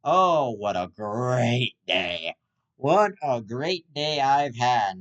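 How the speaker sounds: slow dragged sarcastic moan; sarcastic drawl. Acted. Podcaster moans about his ruined day, sarcastic. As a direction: drag it out sarcastically.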